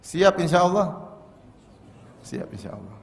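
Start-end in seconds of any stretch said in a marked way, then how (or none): 2.2-3.0 much softer and lower, half-hearted; reluctant mumbled reply. Pretend. Congregation's reluctant answer to the preacher, soft half-hearted mumble. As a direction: mumble it back like an unwilling congregation. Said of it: "Berat kayaknya".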